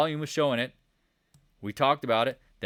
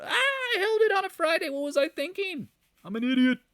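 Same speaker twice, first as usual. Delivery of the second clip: very high, loud hammed-up wail; squealing mock despair; mock wailing panic; 4 dB louder than usual. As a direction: wail it like a regretful trader. Mocks panicking traders wailing regret.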